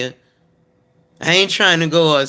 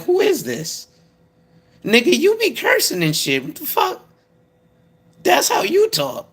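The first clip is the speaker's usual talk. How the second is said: much higher, squeaky indignant God; mock God voice; 13 semitones higher than usual. God scolding someone who hides his cursing, squeaky mock indignation. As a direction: play God as a shrill scolding deity calling out a cursing believer. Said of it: "God gonna look at you and be like".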